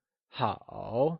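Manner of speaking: 好 slow and soft, dipping then rising; 好 as a careful Mandarin third tone; falling-rising tone. Shown as a demonstration. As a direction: slide 好 down, then lift it. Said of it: "also pronounced using a falling".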